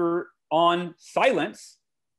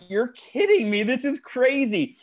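higher, stunned disbelief; amazed disbelief; 7 semitones higher, slower than usual. Retold or imitated. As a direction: react like your jaw dropped. Jaw dropped, amazed reaction.

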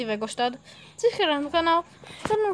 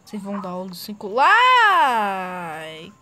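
slow, louder, stretched mock voice; drawn-out mock voice; 3 semitones lower, 7 dB louder, slower than usual. Shown as a demonstration. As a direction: stretch it out in a silly voice. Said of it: "repeats line drawn out".

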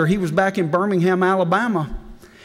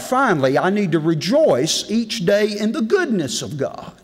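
normal talking voice

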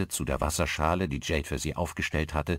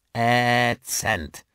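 drawn-out, higher-pitched disgust; scornful teen; 6 semitones higher, 5 dB louder than usual. A narrator's reading. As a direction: stretch it with teenage disdain. Teen typing scornful verdict.

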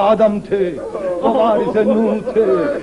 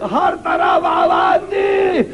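higher, slow, drawn-out wail; anguished cry; 7 semitones higher, slower than usual. A narrator's reading. Preacher tells of her searching everywhere, crying it out. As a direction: stretch the words like a call into the distance.

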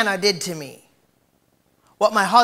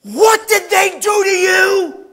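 shrill gasping outrage, higher and louder, slow; mock shocked gossip; 13 semitones higher, 14 dB louder, much slower than usual. Church crowd gasps at the victim's story, hammed-up shouting. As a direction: ham up the busybodies' shock. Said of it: "Everybody goes".

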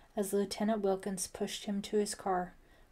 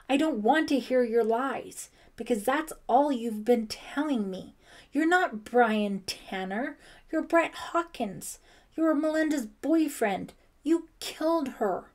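loud, high-pitched angry accusation; angry cry; 5 semitones higher, 8 dB louder than usual. A narrator's reading. Ellie accusing Brian of lying and killing, angry cry. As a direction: hurl each charge at him, louder and higher. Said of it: "Ellie cried angrily".

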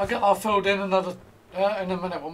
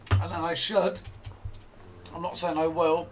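normal talking voice

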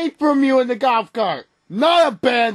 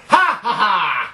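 gloating laugh; 7 semitones lower than usual. Acted. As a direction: cackle at the victim in triumph. Gloating before throwing eggs.

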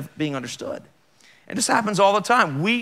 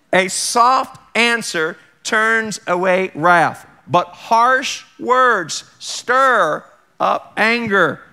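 very slow, higher, louder scripture; slow emphatic reading; 4 semitones higher, 6 dB louder, much slower than usual. Shown as a demonstration. Preacher correcting the misquote, slow and emphatic. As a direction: read each word out slowly.

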